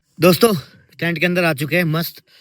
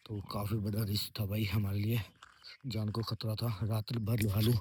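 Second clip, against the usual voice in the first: keeping his voice down so the others can sleep; low-key murmured morning update; quietly talking to camera at dawn; hushed morning talk; 4 semitones lower, 17 dB quieter than usual. Real, not acted. Others asleep inside the tent.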